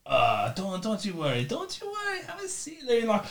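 very soft, murmured comforting; hushed reassurance. Acted. Lawyer to client frozen at the door, hushed reassurance. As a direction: settle a frightened client almost inaudibly.

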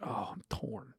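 soft whisper, torn over it. Real, not acted. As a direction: whisper the doubt to yourself. Agonising over the pick.

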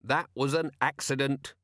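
plain reading voice

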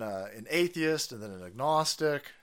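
normal talking voice

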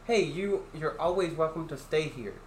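normal talking voice

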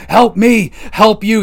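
high, loud mock screaming; shouting over and over at a wrestler, hammed-up yell; imitated scream. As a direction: yell it like a crazed coach. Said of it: "He's screaming that at him".

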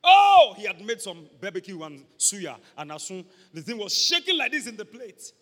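loud, high, relishing the food; excited. Acted. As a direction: gush over the tasty plate. Savouring described food.

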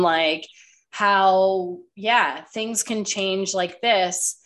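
usual speaking voice

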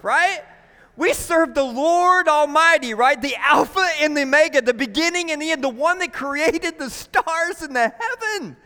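loud, high, rousing preacher delivery; impassioned preaching. Real, not acted. Preacher reminding church whom they serve, impassioned and high.

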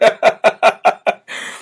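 rapid helpless laugh; real staccato cackle. Real, not acted. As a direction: keep laughing after the joke lands. Cracking up while mocking.

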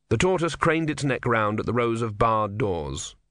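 usual speaking voice

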